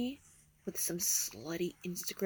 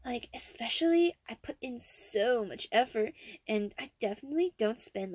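put-on Valley Girl voice; 3 semitones higher than usual. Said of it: "my Valley Girl voice".

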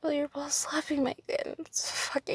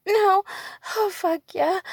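higher and louder outcry; 5 semitones higher, 8 dB louder than usual. Real, not acted. Woman crying out loudly to her partner. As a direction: cry it out.

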